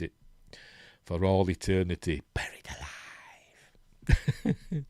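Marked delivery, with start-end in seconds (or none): 2.3-4.8 slow, drawn-out eerie whisper; spooky whisper. Pretend. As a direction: whisper it very slowly, playing up the horror. Jokes about files stored for eternity.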